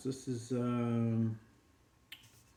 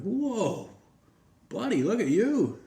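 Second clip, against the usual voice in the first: much higher and louder, wide-eyed; impressed whoa; 9 semitones higher, 4 dB louder, slower than usual. Acted. Small dogs see the Great Dane strut into the clinic, much higher and impressed. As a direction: gape at the huge dog strutting in.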